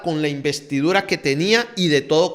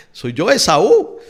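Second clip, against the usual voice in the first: raised, drawn-out mock protest; loud, higher, stretched-out retold outcry; indignant protest; 4 semitones higher, 8 dB louder, slower than usual. Esau insists to his father who he is, mock indignant protest.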